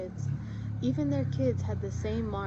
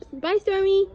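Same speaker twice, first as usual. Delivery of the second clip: high, loud goodbye call; 11 semitones higher, 11 dB louder than usual. Real, not acted. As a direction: call farewell to the cat below. Saying bye to cat.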